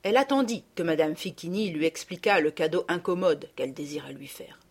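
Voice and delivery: plain reading voice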